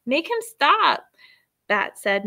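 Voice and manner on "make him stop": high, louder whiny plea; whining. A narrator's reading. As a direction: whine at Mom to make him stop. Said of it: "whined Janie".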